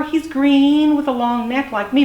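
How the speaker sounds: lightly voiced high youngster; little dinosaur. A narrator's reading. Little dinosaur describes Daddy to stranger, lightly voiced youngster.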